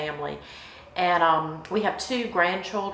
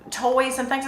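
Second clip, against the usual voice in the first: ordinary talk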